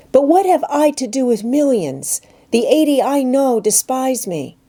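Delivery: a hurt schoolgirl begging to understand; anguished young girl asking her friend; young Jane, pleading. Acted. Jane speaks in dialogue.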